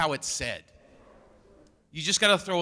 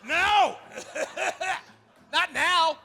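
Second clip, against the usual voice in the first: panicked no; 12 semitones higher, much slower than usual. Real, not acted. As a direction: cut him off in panic. Dad panics at son.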